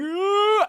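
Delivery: loud high yell of a guy charging in; piercing imitated battle yell; imitated scream. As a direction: scream it high and sudden, mimicking a player. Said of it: "hearing some guy going".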